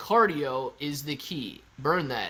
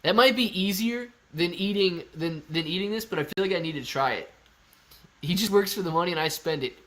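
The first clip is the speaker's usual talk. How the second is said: ordinary delivery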